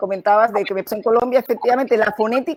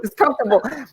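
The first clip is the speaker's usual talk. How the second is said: put-on Spanish-accented English; 2 semitones higher than usual. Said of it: "como tú".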